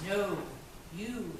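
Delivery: softly voiced man's reply; hushed lover. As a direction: answer softly, a touch intimate. Acted lover's quiet reply.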